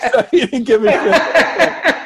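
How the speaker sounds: high, loud words through laughter; laughing. Real, not acted. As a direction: laugh while telling it.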